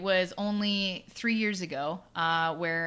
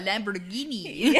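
put-on Italian accent; 4 dB louder, slower than usual. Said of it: "Said in my Italian accent, eh?".

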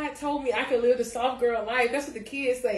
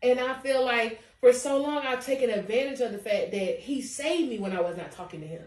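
normal talking voice

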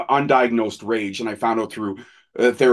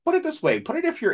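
imitating the doctor's very high, squeaky voice; 14 semitones higher than usual. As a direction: push it way up and squeak like a cartoon. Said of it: "He had a squeaky voice like this".

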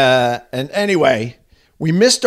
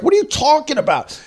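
very high-pitched incredulous question; acted bewilderment; 14 semitones higher than usual. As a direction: re-enact baffled disbelief at a friend. Retold bewildered reply to panicking friend.

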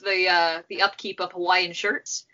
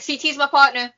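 put-on Boston accent, pitched up a little; 4 semitones higher than usual. Imitating Kara at home claiming her partner, put-on Boston accent. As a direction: play up a rough Boston accent. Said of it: "you went with the different Boston accent".

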